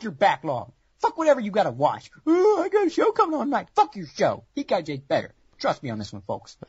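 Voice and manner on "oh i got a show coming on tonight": high, whiny excuse-maker voice; mock the lazy viewer's excuse, pitched way up; squeaky put-on whine. Mocking another viewer excuse.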